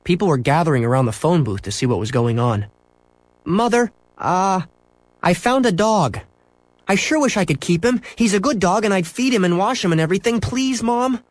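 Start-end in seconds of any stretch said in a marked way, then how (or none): 3.4-11.2 high, earnest begging; pleading boy. A narrator's reading. Boy begging mother to keep a dog, pleading. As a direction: plead high, climbing further on the last words.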